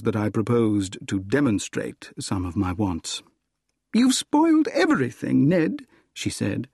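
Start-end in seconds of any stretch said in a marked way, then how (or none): 3.9-5.9 high, louder cry of reproach; acted feminine dismay; reproachful. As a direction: scold him in a raised woman's pitch. Gladys reproaches Ned after his advance.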